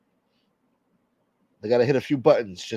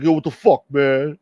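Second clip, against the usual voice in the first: quote; 6 dB louder than usual. Retold or imitated. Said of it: "They get upset, like".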